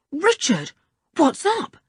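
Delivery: cried out. Acted. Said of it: "cried Julian".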